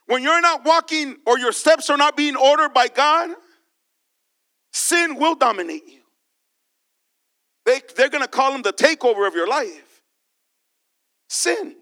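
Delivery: impassioned preacher calling out; preaching. Acted. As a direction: preach it to the church with fire. Preacher addressing congregation.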